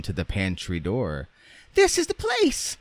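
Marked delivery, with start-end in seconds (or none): 1.7-2.8 very high, piping doll cry; Raggedy Ann crying out. A narrator's reading. Doll announcing the pantry door, crying out. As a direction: squeal it out in falsetto. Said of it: "cried Raggedy Ann".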